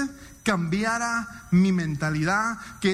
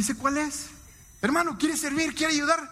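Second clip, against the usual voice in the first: higher, bright-eyed asking voice; mock eager church leader; 6 semitones higher than usual. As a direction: play the pushy usher recruiter. Mimics leader asking to serve. (imitando al líder de la iglesia, más agudo)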